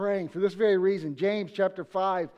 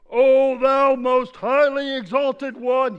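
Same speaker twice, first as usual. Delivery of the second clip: much louder, slow olde English prayer; old-fashioned booming prayer; 4 semitones higher, 9 dB louder, much slower than usual. Retold or imitated. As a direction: boom it out like an old saint. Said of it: "it would go something like this".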